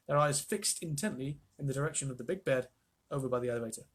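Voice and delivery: plain reading voice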